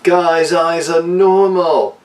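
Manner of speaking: high, slow hammed-up yell; imitating a frantic mother's high, drawn-out yell; mock yell. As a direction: shout it up high like a desperate mother.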